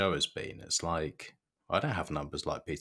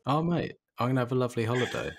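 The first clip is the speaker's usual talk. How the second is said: gleeful gush; 7 semitones higher, much faster than usual. Real, not acted. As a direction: rattle it off, thrilled and grinning. Gushing about family holiday.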